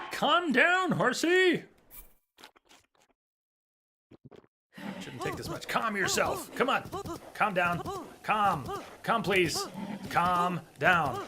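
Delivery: high, pleading calls to settle; coaxing horse. Real, not acted.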